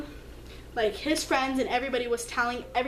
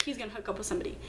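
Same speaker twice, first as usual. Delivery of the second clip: hushed inner thought; 2 semitones lower, 8 dB quieter, much faster than usual. Retold or imitated. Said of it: "I was like".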